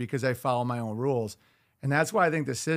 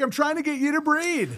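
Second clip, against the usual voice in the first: high-pitched exasperated comeback; exasperated retort; 12 semitones higher than usual. Retold or imitated. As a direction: throw up your hands, pleading.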